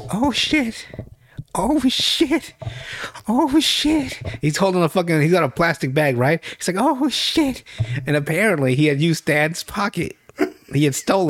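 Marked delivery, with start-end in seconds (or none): 0.1-4.2 each oh shit climbing higher, panicky; a panicked player losing it more with every repeat; rising panicked oh shit. Retold or imitated. Player panics leaving bathroom. 6.8-7.6 high, panicky oh shit; a caught player blurting out in alarm; panicked oh shit. Retold or imitated. Player panics holding the bag.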